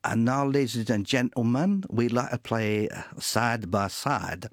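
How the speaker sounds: imitated Cockney bandleader announcement; mock Cockney stage intro, drawing out the last words slowly; a friend lightly taking off a Cockney announcer's voice; Cockney accent. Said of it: "a kind of Cockney accent".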